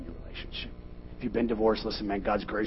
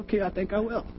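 cocky reply; 11 semitones higher than usual. Retold or imitated. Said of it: "And I said".

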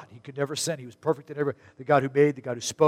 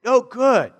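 exasperated; 9 semitones higher, 5 dB louder than usual. Retold or imitated. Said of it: "I'm like".

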